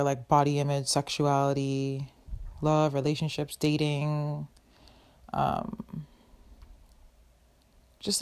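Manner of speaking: ordinary talk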